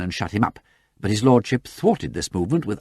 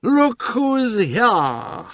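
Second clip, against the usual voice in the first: put-on much higher, very slow drunken cheer; cheering cry; 4 dB louder, much slower than usual. Freddy cheers at the others from the taxi, high and slow. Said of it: "cried the Honourable Freddy".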